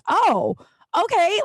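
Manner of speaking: high-pitched surprised reaction; taken aback. Retold or imitated. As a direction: act out being startled into agreeing. Retelling own reaction to the hype.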